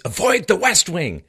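loud, high, slowed theatrical warning; hammed-up movie warning; dramatic warning. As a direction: boom it out as a forbidding order. Quotes the film's warning dramatically.

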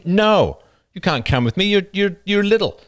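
dismissive big sibling. Retold or imitated. Older sibling turning the little one away from the party, dismissive. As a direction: talk down to the kid, shut them out.

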